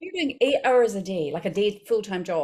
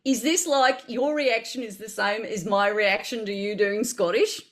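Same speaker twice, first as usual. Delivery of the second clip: put-on posh Australian; Australian accent. Shown as a demonstration. Actor asking Australian host's verdict, in posh Australian accent. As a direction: speak in a careful Australian accent. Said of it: "when you hear me doing Australian".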